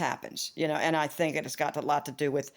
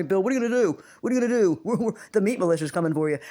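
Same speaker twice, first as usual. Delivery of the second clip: louder, higher, rushing teasing warning; mock teasing alarm; 3 semitones higher, 4 dB louder, much faster than usual. Vlogger teasing Bill about his many followers, mock alarm. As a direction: tease Bill that they're coming for him. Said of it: "I'd be overwhelmed as hell".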